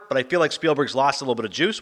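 ordinary delivery

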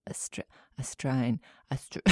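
breathy whisper, sounding out "Australian" to yourself; whispered trial pronunciation. Retold or imitated. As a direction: whisper "Austra-, Australian" under your breath, testing the syllables. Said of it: "being like".

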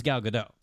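GADOT said guh-DOH; silent T. Shown as a demonstration. Recalling how he thought her name was said, silent T. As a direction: end "Gadot" on an open OH, no T. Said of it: "she actually pronounced the T".

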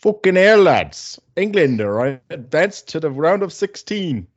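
put-on loud, high English football fan accent. Cohost celebrates England like an English fan, English accent. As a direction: chant it like an English supporter. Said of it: "I can't talk for too long in an English accent".

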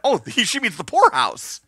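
ordinary talk

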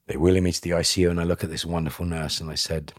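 normal talking voice

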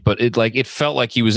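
ordinary talk, nothing marked

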